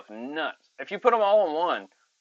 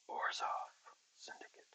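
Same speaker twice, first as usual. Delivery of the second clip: whisper; 18 dB quieter than usual. Real, not acted. Whispered card name aside.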